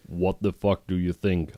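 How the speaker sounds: plain reading voice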